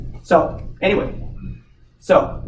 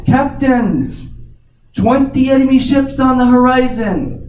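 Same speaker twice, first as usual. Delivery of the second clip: much higher and louder alarm call; lookout yelling down; 12 semitones higher, 8 dB louder, slower than usual. Acted. Lookout yells down to the captain, loud high alarm. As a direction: shout it down from the crow's nest in alarm. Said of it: "yells down again".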